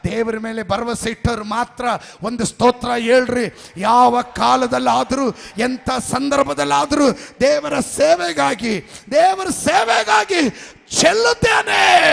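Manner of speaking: rising from firm call to full shout; building preaching. Acted. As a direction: preach it up till you're shouting. (ಜೋರಾಗಿ ಏರುತ್ತಾ ಹೋಗುವ ಪ್ರಸಂಗ)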